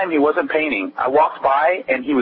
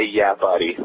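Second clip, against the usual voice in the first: normal talking voice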